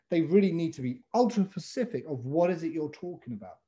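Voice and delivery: ordinary talk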